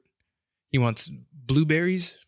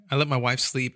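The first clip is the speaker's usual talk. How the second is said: ordinary delivery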